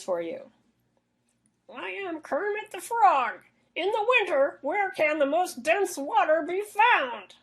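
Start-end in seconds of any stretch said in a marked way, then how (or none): 1.7-7.3 high, slow puppet frog voice; Kermit the Frog voice. Acted. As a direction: squeeze it up into a slow cartoon frog. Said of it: "me doing a Kermit the Frog voice".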